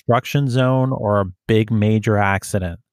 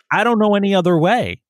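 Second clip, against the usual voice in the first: whiny lost driver; 9 semitones higher, faster than usual. Retold or imitated. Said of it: "And they're like".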